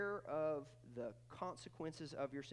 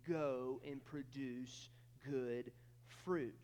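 slow command; 2 semitones lower, much slower than usual. Retold or imitated. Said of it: "he's saying".

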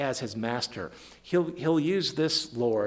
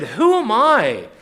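raised, slow, loud scripture reading; stretched, ringing reading of a prayer; emphatic scripture reading; 4 semitones higher, 9 dB louder than usual. Acted. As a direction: proclaim David's prayer to the congregation. Reads scripture aloud with emphasis.

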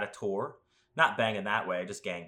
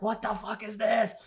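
voicing a panicked dog, pitched much higher; scared dog voice; 13 semitones higher, 4 dB louder than usual. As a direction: squeal it like a terrified dog. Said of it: "He was so scared".

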